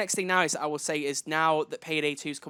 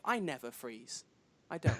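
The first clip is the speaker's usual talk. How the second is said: slow, much softer, deadpan movie quote; quoted film line; 3 semitones lower, 9 dB quieter, slower than usual. Retold or imitated. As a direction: say it cool and quiet like the film hero.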